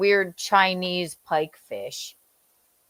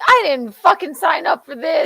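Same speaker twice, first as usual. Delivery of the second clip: indignant shout; 8 semitones higher, 3 dB louder than usual. Retold or imitated. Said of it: "She's like".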